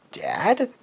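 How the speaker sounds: a stunned, rising question; surprised question. Acted. Son recognising the man in the truck, surprised. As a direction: voice swooping up high in disbelief.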